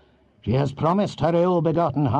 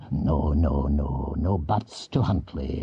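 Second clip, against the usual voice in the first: put-on a lower voice for the grim old Earl; old Scottish chief; 6 semitones lower than usual. A narrator's reading. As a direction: speak down low as a seasoned lord giving orders. Huntly cuts Patrick off.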